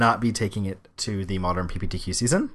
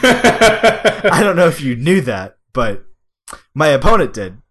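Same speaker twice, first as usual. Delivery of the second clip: laughing; 4 semitones higher, 9 dB louder, slower than usual. Real, not acted. Laughing at own misplay.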